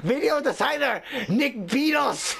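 mock-grand Japanese-accented roll call; horrible Japanese accent. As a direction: announce each name, stretched, in a fake Japanese accent. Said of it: "doing a horrible Japanese accent".

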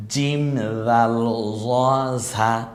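জিম, জাল, জ, ঝা each drawn out very slowly; slow letter drill. Shown as a demonstration. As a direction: separate the four letters clearly. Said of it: "এই চারটা হরফ একটু আলাদা করেন". (ধীরে আলাদা করে চার হরফ উচ্চারণ)

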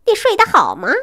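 high, ingratiating sweet question; butter him up; fawning. Acted. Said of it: "他挤出一脸讨好的表情说". (讨好地问)

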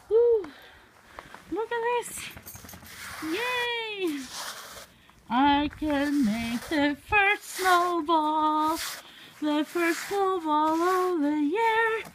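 high, drawn-out singsong delight; gleeful. Real, not acted. Making the first snowball of the year, gleeful singsong. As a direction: savour each word in high, slow glee.

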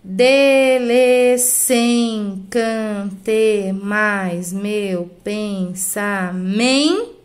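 extremely slow, every syllable stretched; chant-like drawn-out syllables; syllable-by-syllable scansion. Shown as a demonstration. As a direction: scan the line one long syllable at a time. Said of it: "Vai contar só até aqui".

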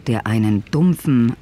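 normal talking voice

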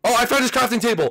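high, thrilled announcement of the find; excited find. Real, not acted.

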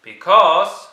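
very loud, high shout; group shout. Acted. The children shouting to George, very loud. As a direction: shout it out together.

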